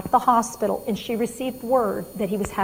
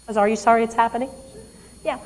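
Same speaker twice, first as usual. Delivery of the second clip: usual speaking voice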